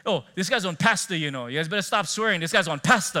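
coach. Retold or imitated. Coach warning the players about the new pastor, loud coach voice. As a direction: call out like a coach warning the team. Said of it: "the coach goes".

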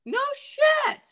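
loud, very high burst of surprise; amazed exclamation. Real, not acted. Friend hears about the [name] show, amazed exclamation. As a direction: squeal it in real disbelief. Reacting to friend's concert news.

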